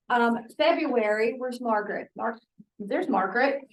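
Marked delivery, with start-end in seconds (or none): none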